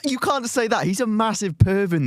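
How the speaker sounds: laughing protest. Real, not acted.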